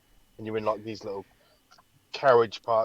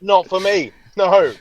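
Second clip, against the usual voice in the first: higher, louder laughing no; laughing refusal; 7 semitones higher, 9 dB louder, slower than usual. Retold or imitated. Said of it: "I'm gonna be like".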